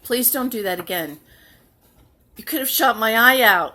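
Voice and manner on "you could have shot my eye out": loud mock-scolding movie line; movie quote. Quoting a movie line to the child, loud mock scold. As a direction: shout it like the famous warning. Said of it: "That was a quote from a movie".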